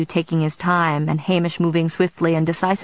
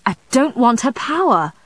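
heated outburst, pitched up; exploding; 7 semitones higher, 3 dB louder than usual. A narrator's reading. Vampire denies his friend's suggestion, exploding in anger. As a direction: burst out in indignant denial. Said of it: "Matthew exploded".

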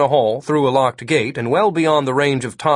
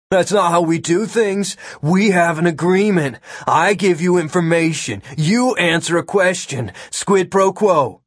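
put-on higher-pitched kid laying down the rules; scolding; 6 semitones higher than usual. A narrator's reading. Kid reminding the agent of their agreement, scolding. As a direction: scold him in a raised, insistent voice. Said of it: "scolding Agent Miller".

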